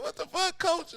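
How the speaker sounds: squeaky high mock outrage; shocked protest. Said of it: "I was like".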